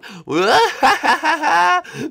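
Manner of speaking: evil laugh. Acted. Villain laughs after being called evil.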